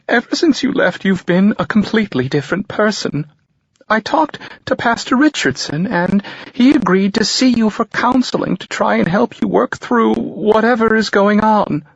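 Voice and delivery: high, tearful mother's voice; a pious mother pleading through tears; mother pushing counseling on her son, high and tearful; worried mother. A narrator's reading. Mother on the phone.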